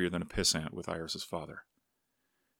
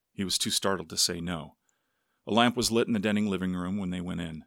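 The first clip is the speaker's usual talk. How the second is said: usual speaking voice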